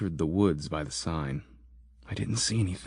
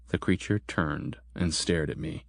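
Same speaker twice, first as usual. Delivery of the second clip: ordinary delivery, nothing marked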